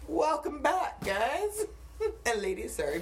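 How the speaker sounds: ordinary talk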